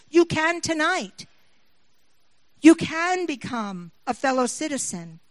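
loud, fast preacher's altar call; preaching. Acted. Preacher calling the congregation to respond, in a loud, driving voice. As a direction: press the congregation, punching each line.

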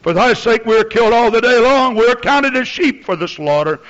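high, loud ringing scripture recital; impassioned pulpit shout; full-force preaching. Real, not acted. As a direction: proclaim the verse forcefully to the congregation.

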